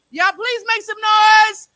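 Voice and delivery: hype shout. Real, not acted. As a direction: pump up the crowd loudly. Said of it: "hyping the crowd for the poet".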